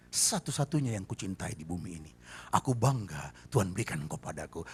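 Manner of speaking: low, soft loving words to a wife; tender husband. Acted. Husband telling his wife he still loves her, soft and low. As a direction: coax her gently, quiet and low.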